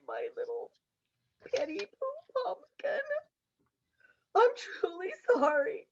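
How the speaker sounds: tearful, broken apology; crying. Acted. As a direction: sob through the words. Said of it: "Ginger starts to cry".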